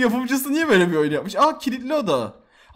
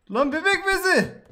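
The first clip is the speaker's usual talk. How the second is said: shocked; 9 semitones higher than usual. Real, not acted. Shocked at flying diaper.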